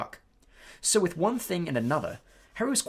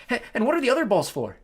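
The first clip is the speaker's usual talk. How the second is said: put-on eager boy voice; 10 semitones higher, 7 dB louder than usual. Colin eager question.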